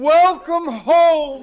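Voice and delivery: very loud, high and slow; husband proudly presenting the farmhouse; proud announcement. Acted. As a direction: call it out grandly.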